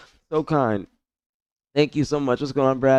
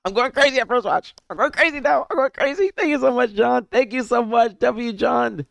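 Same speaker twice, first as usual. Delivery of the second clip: high-pitched, squealing joy; overjoyed, voice pushed very high; tearful excitement; 14 semitones higher than usual. Real, not acted. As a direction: squeal with happy tears coming. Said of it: "that's me really crying".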